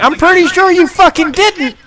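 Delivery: retold comeback, high-pitched and exasperated; indignant retort. Retelling a bedroom argument to the co-hosts, indignant.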